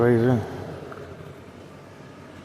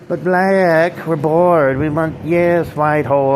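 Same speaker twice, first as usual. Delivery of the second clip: higher, louder alter-ego voice; a raised, louder other voice butting in; 6 semitones higher, 5 dB louder, slower than usual. Acted. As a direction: pitch up and push it like a pestering second self.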